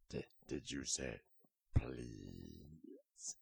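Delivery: low, slow astonished croak; a big beast amazed; deep alligator voice. A narrator's reading. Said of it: "And the alligator said".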